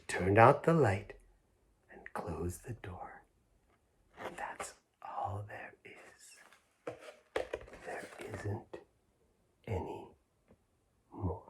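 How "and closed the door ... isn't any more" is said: fading whispered ending, slow. A narrator's reading. Ending a bedtime story, slow fading whisper. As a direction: let the story close in a hush.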